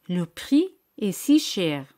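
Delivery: Le prix est si cher, slow and careful, leaning on si; slow repeat. Shown as a demonstration. Teacher gives a final slow repeat for students to copy. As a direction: say it slowly, leaning on si. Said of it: "Ahora di".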